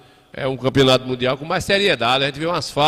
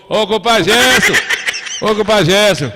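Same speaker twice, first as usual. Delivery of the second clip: calling out; 6 semitones higher, 5 dB louder than usual. Real, not acted. Host hails compadre Gerson on air, calling out. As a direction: holler a hearty greeting across to a friend.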